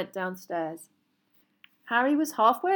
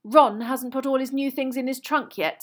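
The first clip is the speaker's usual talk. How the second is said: high, loud complaint; put-upon tattling; long-suffering; 6 semitones higher, 7 dB louder than usual. A narrator's reading. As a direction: complain as the one who always suffers. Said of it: "in a long-suffering voice".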